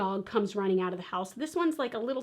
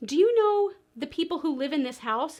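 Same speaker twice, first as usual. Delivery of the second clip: higher, raised call to a far neighbor; calling from afar; 6 semitones higher, 3 dB louder than usual. Retold or imitated. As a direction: project it across two houses. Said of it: "So from very far away".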